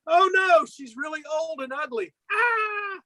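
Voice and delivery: high cry of disgust and fright; high mock horrified yelp. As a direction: recoil in comic horror. Jack recoils from old woman.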